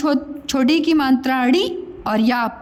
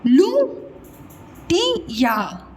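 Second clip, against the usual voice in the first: लुटिया stretched long; लुटिया dragged out syllable by syllable; very slow, drawn out; 3 semitones higher, much slower than usual. Shown as a demonstration. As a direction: hold every sound of लुटिया. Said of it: "लिख दिया ऐसा गलती से".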